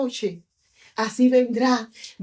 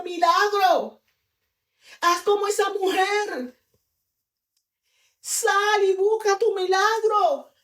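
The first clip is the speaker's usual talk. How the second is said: high-pitched fervent sermon delivery; impassioned preaching; 5 semitones higher than usual. Acted. Preacher urging the congregation to go seek their miracle, impassioned.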